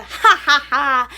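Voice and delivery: loud mock cackle; loud mocking laugh. Mocking the laugh she is accused of, loud mock cackle. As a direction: belt out a fake laugh at the mistake. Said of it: "it wasn't like a".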